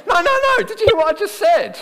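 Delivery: high, loud outburst at a shrugging listener; mock outraged shout. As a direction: cut them off in mock indignation.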